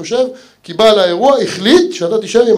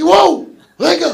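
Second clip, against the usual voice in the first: mock wow; 10 semitones higher than usual. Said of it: "אמרתי".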